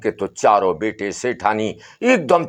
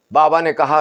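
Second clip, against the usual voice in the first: ordinary delivery, nothing marked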